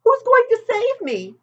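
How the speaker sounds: high, loud hopeful plea; urgent little pig; eager pleading. A narrator's reading. Wilbur pleading.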